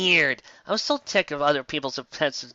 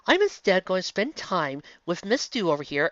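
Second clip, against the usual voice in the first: usual speaking voice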